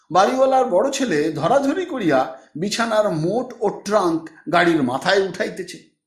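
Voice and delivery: ordinary delivery, nothing marked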